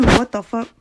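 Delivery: plain reading voice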